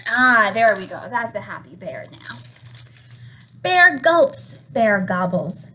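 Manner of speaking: plain reading voice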